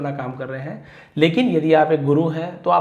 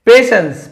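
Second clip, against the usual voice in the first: Patience called out much higher and loud; teacher's high loud model of patience; loud model word; 7 semitones higher, 12 dB louder, slower than usual. Shown as a demonstration. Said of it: "patience यानी धैर्य रखना".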